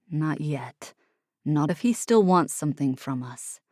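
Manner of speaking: low grim defiance; grim Oliver. Acted.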